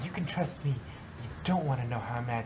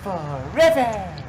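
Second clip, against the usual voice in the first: a big drawn-out yell; 4 semitones higher, 13 dB louder, much slower than usual. Acted. Pinkie popping out of a bush at her friends, yelling. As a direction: throw it out slow and loud, all in. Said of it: "Yelled Pinkie Pie".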